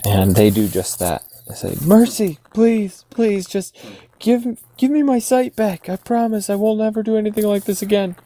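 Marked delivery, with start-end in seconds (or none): 1.8-8.2 high-pitched, frantic pleading; begging for mercy. Acted. Blinded ruffians beg Min for their sight, high desperate pleading. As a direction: plead desperately in a much higher voice. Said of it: "going to beg mercy at this point".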